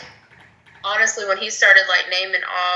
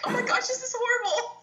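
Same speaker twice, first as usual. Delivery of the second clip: much higher, softer mock dismay; dismayed reaction; 7 dB quieter than usual. Recalling her reaction to the cuts, much higher, softer mock dismay. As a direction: act out watching players get cut, upset and high. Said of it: "I feel sad because I watched it".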